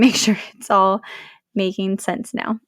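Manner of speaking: ordinary talk, nothing marked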